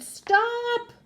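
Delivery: high, loud shout; sudden high-pitched holler; full-out yell. A narrator's reading. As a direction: yell as loud as you can. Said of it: "Tia yelled as loud as she could".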